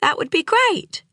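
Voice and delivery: high, sugary mock neighbour voice; gushing lady. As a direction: imitate the pregnant lady's delight. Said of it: "the lady had been all".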